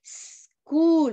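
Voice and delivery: "School" as a long sss, then a drawn-out "cool"; isolated S sound. Shown as a demonstration. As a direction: demonstrate the opening hiss of "School" before the rest. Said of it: "Vuelvo a repetir".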